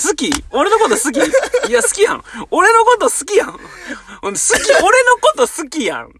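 high, laughing parody of a brainwashing pitch; mocking pushy suitor. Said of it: "まくし立てる時あるやん".

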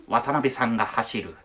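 low, hushed documentary narrator; documentary narration voiceover. Retold or imitated. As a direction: read it like a serious TV documentary. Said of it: "つって".

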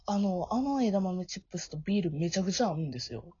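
ordinary delivery

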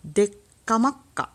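put-on Kansai dialect; fake Kansai dialect. Shown as a demonstration. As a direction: punch out the fake Kansai phrase. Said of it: "エセ関西弁八".

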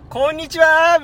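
mock children's TV singer saying hello; chirpy greeting. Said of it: "みたいなテンションで".

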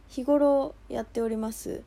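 usual speaking voice

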